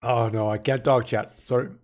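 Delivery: talking through hiccups; hiccuping between words. Real, not acted. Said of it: "I'm getting the hiccups".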